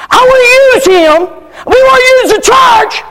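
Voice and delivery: high, shouted demanding voice; mock couple. Preacher mocking a couple using the church, high shout.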